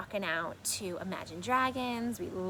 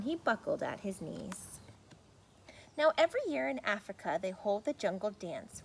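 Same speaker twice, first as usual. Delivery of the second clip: lively storybook read-aloud; reading to children; 3 semitones higher than usual. A narrator's reading. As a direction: read it like bedtime story for kids. Reads picture book aloud.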